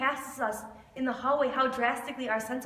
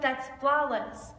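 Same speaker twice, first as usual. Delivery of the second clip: slow and deliberate; much slower than usual. Acted. Refrain after each question.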